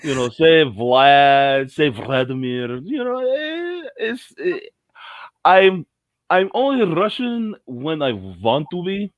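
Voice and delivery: high-pitched, hammed-up Russian accent; exaggerated Russian-accented English. Shown as a demonstration. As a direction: play a comic Russian, stretching the words. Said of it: "I do Russian accent very good".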